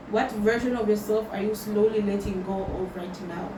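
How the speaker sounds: ordinary delivery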